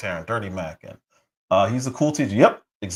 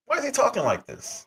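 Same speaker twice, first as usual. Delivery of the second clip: much higher, baffled repeat; disbelieving rhetorical question; exasperated; 10 semitones higher, 4 dB quieter than usual. Real, not acted. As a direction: throw up your hands and ask again.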